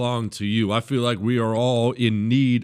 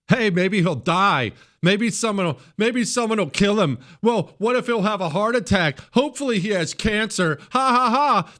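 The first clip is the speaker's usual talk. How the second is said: mock gleeful hater; 10 semitones higher, 3 dB louder than usual. Host imitating Democrats wishing for a death. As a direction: ham up a gloating Democrat. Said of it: "you have heard them speak like that".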